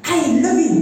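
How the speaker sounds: loud, high, brusque declaration; mismatched, unloving tone. Shown as a demonstration. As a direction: throw it out sharply, without tenderness. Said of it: "saya nggak bisa bilang gini". (ketus dan keras)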